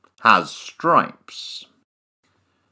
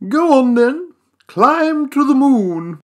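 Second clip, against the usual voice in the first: teasing challenge; 11 semitones higher, 4 dB louder than usual. Acted. Challenging the mouse.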